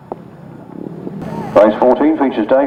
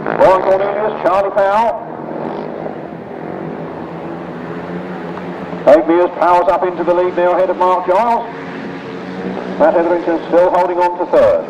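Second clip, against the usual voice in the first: raised, lively play-by-play; live race commentary; 6 semitones lower than usual. Real, not acted. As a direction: call the race as it happens.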